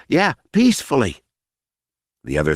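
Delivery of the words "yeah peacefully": high, hasty nervous agreement; nervous high echo. Acted. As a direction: squeak out "peacefully" in agreement. Smiley echoing nervously.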